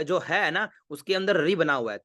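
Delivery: ordinary talk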